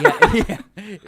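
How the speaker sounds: loud laugh through the words; cracking up mid-word. Real, not acted. Laughing at the video.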